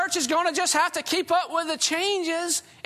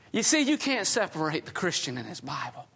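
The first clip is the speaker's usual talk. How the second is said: ordinary talk, nothing marked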